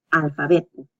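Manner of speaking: "Alfabeto" run together as one word; said fast, joined up. Shown as a demonstration. Teacher showing the final practice step, "Alfabeto" said fast. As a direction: say "Alfabeto" in one quick go, no breaks. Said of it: "try to say it fast".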